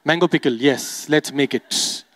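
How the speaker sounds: a hint of a dreamy creative person; mock creative type musing, low and soft; creative people. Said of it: "I'm sure the creative people like".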